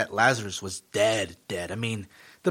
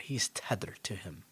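whisper; 4 semitones lower, 12 dB quieter than usual. Real, not acted. Preacher drops to a whisper.

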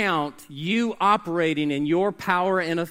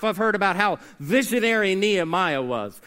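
ordinary delivery

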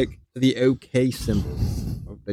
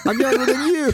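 laughing. Real, not acted. Laughing protest at teasing.

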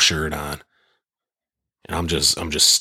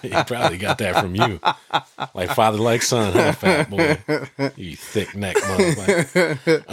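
higher, laughing mock insult; laughing tease; 7 semitones higher than usual. Real, not acted. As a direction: rib him through giggles. Teasing the friend's son.

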